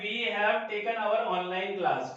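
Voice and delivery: slow dictation. Shown as a demonstration. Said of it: "एक example से समझ सकते हैं".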